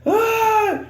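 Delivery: a very high laughing-crying shriek; panicked squeal. Retold or imitated. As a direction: let out a high half-laughing wail. Peak of retold panic.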